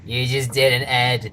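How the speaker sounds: a lightly put-on Billy voice, flat; deadpan Billy echo, lightly voiced; Billy impression. Host repeating his Billy line, mildly put-on deadpan. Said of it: "That's my Billy impression".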